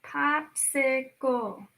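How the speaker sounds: Pop-si-cle slow and soft; soft slow syllables. Shown as a demonstration. Teacher softly repeating the word. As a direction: hush and sound out Popsicle. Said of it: "How many claps did you hear?".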